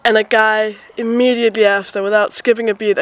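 usual speaking voice

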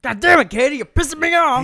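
high, full-volume mock rage; screamed radio-host meltdown; DJ losing it. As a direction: explode at the producer, in pretend fury. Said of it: "secretly losing his shit".

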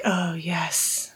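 breathy whisper. Retold or imitated. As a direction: hush it out in a whisper. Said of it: "he's just like".

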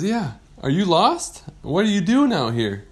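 high, curious questioning; friendly shark character. Acted. Shark questioning a lost pirate, high curious voice.

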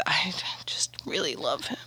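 soft whispered confession; breathy, hushed admission. Real, not acted. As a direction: whisper it like a secret crush.

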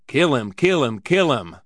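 loud, jeering singsong repetition; gleeful taunting chant; mocking mimicry. A narrator's reading. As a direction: mimic him tauntingly, each repeat falling. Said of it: "he mimicked".

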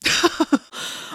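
short breathy bitter laugh; a scornful huff of a laugh. Acted. Gwen mocking August's claim, bitter laugh. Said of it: "Gwen laughed bitterly".

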